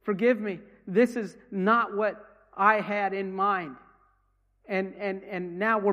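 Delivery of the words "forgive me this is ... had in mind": crying out. Retold or imitated. The dying man crying out to the Lord, louder. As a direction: voice the man's plea, a touch of tears. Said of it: "He's, he's crying".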